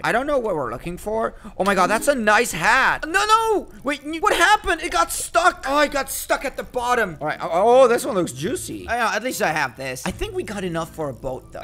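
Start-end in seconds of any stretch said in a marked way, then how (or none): 3.0-7.2 alarmed. Real, not acted. Hook stuck at the bottom.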